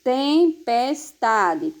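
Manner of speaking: "Tempestade" very slowly split as tem, pes, ta, de; teacher's slow, measured "Tem... pes... ta... de"; syllable by syllable. Shown as a demonstration. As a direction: break "Tempestade" into four drawn-out syllables. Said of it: "quatro sílabas".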